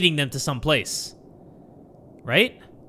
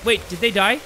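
usual speaking voice

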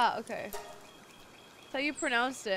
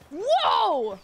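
loud, high cry of surprise; startled yell; 6 semitones higher, 9 dB louder than usual. Real, not acted. Player startled mid-game, loud surprised yell. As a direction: yell out, caught off guard.

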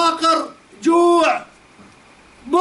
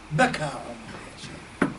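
sorrowful hush; 13 semitones lower, 11 dB quieter, slower than usual. A narrator's reading. Umar weeps at the riches.

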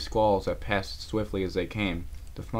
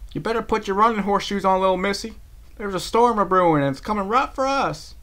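high, loud country call; 12 semitones higher, 7 dB louder than usual. Acted. Applejack calls to Rarity about the storm, loud. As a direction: holler a warning across the trail. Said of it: "She cried".

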